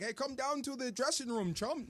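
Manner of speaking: put-on light Canadian hockey-player accent; a hint of a Canadian locker-room guy. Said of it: "that's a good Canadian accent".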